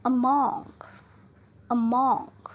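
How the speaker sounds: Among with MONG loud; stress on second syllable. Shown as a demonstration. Teacher showing preposition stress on Among's second syllable. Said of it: "second syllable पर traced है".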